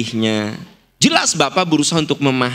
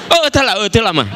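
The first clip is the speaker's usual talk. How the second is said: teasing impression of a colleague's protest; mock Pak Kingking; 10 semitones higher, faster than usual. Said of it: "Pak Kingking mah". (menirukan Pak Kingking menolak dengan suara tinggi)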